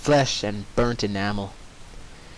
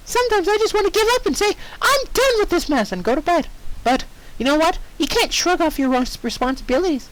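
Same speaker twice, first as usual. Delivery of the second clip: much higher, fast chirpy pep talk; high mock peppy voice. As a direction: lecture brightly like a cartoon. Dash speech read as Pinkie voice.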